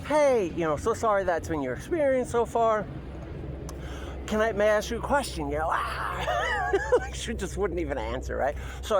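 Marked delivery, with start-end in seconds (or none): 0.1-5.5 sweet service voice. Retold or imitated. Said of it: "I say".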